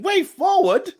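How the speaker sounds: high, loud disbelief; incredulous inner reaction. Retold or imitated. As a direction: echo the suggestion as if absurd. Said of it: "In my head, I'm like".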